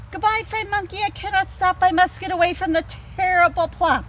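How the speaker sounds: high, urgent, scared voice; fleeing animal. Acted. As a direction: hurry the goodbye in a fright.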